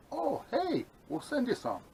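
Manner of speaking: high bright mock company rep; mock cheerful voice. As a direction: squeak it like a cheery brand rep. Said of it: "they would say".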